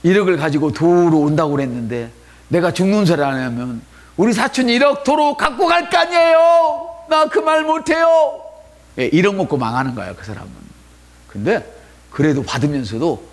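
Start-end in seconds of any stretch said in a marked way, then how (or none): 0.0-8.4 lightly voiced complaining churchgoer; mock whining believer. Pastor mimics a whining believer, mock whine.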